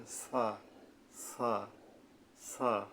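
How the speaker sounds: সা with the tongue tip at the upper front teeth, soft and lisped; soft Arabic tha. Shown as a demonstration. Teacher demonstrating the letter tha to students, soft and lisped. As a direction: say সা gently with a th sound, three times. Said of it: "নরমভাবে আদায় হবে".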